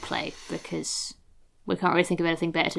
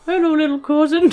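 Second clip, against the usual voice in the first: put-on high voice; 10 semitones higher, 6 dB louder than usual. Character greets cousin in voice.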